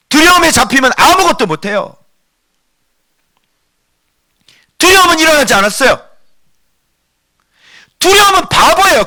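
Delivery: high, insistent sermon voice; impassioned preacher at high pitch; forceful preaching. Real, not acted. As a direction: drive the questions home to the congregation.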